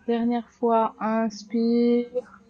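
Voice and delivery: firm, clear cues over the breath; yoga class cueing. Acted. Yoga teacher guiding students through sun salutations, firm cues. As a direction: call out each pose and breath to the class.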